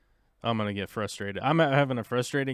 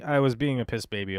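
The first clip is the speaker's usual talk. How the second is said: usual speaking voice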